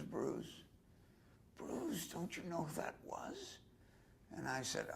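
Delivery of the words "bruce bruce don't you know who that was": a soft high Marilyn voice, near tears; breathy hush, voice lifted high in distress. Retold or imitated. Bruce echoes Marilyn after Garbo drives off, soft and high.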